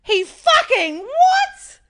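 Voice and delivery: high, slow shriek of disbelief; shocked disbelief. Retold or imitated. Friend shrieking in shock at the breakup news. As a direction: screech it, half laughing. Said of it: "She's like".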